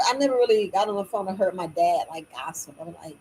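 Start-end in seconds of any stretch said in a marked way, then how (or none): none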